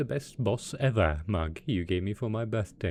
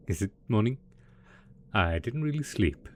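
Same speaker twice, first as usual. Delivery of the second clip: ordinary talk, nothing marked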